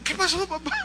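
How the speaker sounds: put-on high child voice; acted naive kid; innocent little boy. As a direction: ask dad as if nothing happened. Boy answering furious father.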